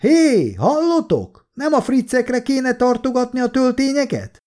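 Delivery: very high, carrying shout; high, stretched-out distant call; calling through cupped hands. A narrator's reading. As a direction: holler a sarcastic question to far-off soldiers. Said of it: "Tölcsért csinált a kezéből".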